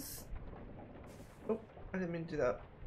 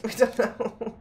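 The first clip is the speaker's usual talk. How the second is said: laughing; 5 semitones higher, 8 dB louder, slower than usual. Real, not acted. Player amused by yet another unknown, laughing. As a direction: laugh it out, bright and loud. Laughing at her own refrain.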